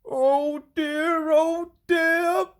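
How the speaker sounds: high anxious engine voice; Henry creeping into the darkness, worried; worried puffing. A narrator's reading. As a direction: puff it out, fretting. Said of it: "puffed Henry".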